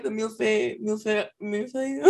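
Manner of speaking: millefeuille, millefeuille said quickly and sloppily, laughing; tossing off millefeuille, half laughing; mumbled French word. Retold or imitated. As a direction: rattle off millefeuille carelessly. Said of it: "I was like".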